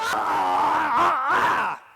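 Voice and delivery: high, loud imitated cry-shouts; mock angry yell. Coach imitating shouting students with high mock cries. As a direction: ape a young actor straining to yell. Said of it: "or something".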